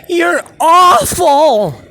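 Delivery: high, loud, very slow drawn-out mock shout; retold shout. Neighbor booing the guitar playing out his window, drawn-out mock shout. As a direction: stretch out awful in a jeering yell. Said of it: "And dude's like".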